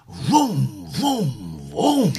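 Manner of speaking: rising mock engine vroom; car vroom noise.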